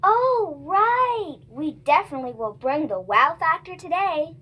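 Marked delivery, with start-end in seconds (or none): 0.0-1.4 high, swooping "oh, right"; exaggerated dawning realization; sudden realization. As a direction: act out suddenly remembering, pitch soaring. Scripted sudden remembering of topic.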